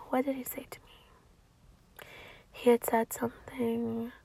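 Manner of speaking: slow whisper, thinking aloud; slow private whisper; whispered to self. Real, not acted. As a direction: murmur it slowly to yourself, searching memory. Muttering to self, trying to recall.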